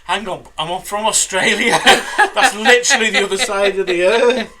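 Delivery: loud and high, cracking up as you quote; giggling through an indignant quote; laughing retelling. Retold or imitated. Podcaster laughing while retelling the actor's protest.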